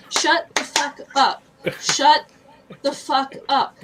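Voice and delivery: loud, slow, spaced-out scolding; re-enacted fed-up telling-off; retold angry outburst. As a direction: hammer out each word in exasperation. Acts out fury at girls.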